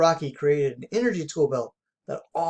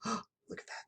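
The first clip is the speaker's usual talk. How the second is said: amazed whisper; 5 semitones higher, 16 dB quieter than usual. A narrator's reading. Amazed gasp over the icy lake.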